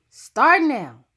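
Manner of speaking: sharp loud call to restart the race; calling over her shoulder. Acted. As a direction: yell it back at the rival as you run off. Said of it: "Charmaine calls over her shoulder".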